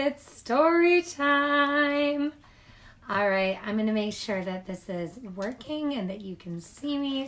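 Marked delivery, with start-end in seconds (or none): 0.0-2.3 high, slow sing-song announcement; excited. Real, not acted. Teacher opening story time to children, excited.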